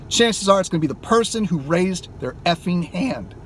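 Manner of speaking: ordinary delivery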